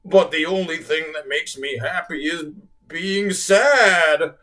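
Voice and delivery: slow, stuttering sad whale. A narrator's reading. Whale answering the children, slow and stuttering. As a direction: stammer through the sadness. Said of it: "the whale stuttered".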